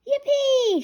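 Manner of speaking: little pig squealing with joy; excited shout. Acted. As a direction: cheer it out. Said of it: "shouts Peppa".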